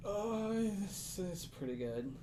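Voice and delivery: high, soft, slow gnome voice; shaken gnome. Acted. Gnome shaking after drinking blood, softly pleased. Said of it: "kinda shakes a little bit".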